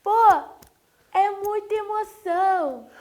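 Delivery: high, loud, drawn-out showgirl squeal; hammed-up overcome fan, stretching the words; mock paniquete gushing. Lady to reporter about her school, mock gushing. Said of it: "Assim, igual paniquete".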